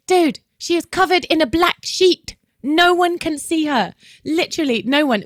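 high, emphatic protest; retold exasperation. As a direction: replay arguing the obvious in disbelief. Retold exasperated argument with the teacher.

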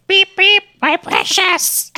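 high, slow Gollum croon. Retold or imitated. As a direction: squeak it out like a tiny Gollum. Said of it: "super deformed Gollum, just being like".

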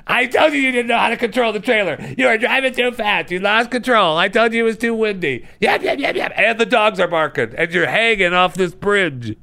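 high-pitched mock nagging tirade; hammed-up screechy hollering, no let-up; nagging wife. Wife berates husband while they hang off the bridge, mock nagging. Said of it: "screaming, hollering".

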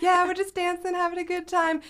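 high, gushing party-instructor voice; sweet Jekyll side. Acted. Friend imitating the instructor's Jekyll side, high and cheery. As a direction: lift it way up, playful mock cheer. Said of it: "it's like a Jekyll and Hyde situation".

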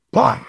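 short, high-pitched impact noise; imitated punch. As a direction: punctuate the story with a sudden sound effect.

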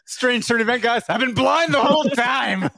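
high, loud hammy Teddy voice; mock Teddy Roosevelt. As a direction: ham up a big reveal. Playing Roosevelt revealing blindness.

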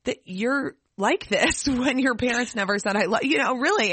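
high, amazed "really?"; surprised disbelief. Retold or imitated. Telling a loving friend she can't believe his parents never said it, pitched high in surprise. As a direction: let your disbelief lift the voice high. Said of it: "And I was like".

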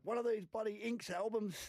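high, scolding mum voice; imitating a cross mother; angry mum. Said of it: "my mum was like".

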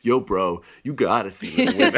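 loud, fast bro voice; frat bro. Acted. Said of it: "Get your bro together".

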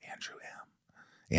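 slow breathy whisper; hushed, slow whisper; whispered name. Real, not acted. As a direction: whisper it like a secret.

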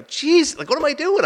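high-pitched self-reproach; exasperated. Retold or imitated. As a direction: question your own decision in dismay. Acted dismay at sweating en route.

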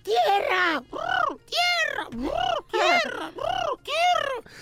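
high squawking parrot voice; piercing bird-like lookout call; parrot cry. Acted. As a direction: squawk the word out like a ship's parrot. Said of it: "el perico empieza a gritar".

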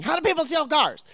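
high, loud, exasperated question; exasperated retort. Retold or imitated. Answering mom about the stolen car, exasperated.

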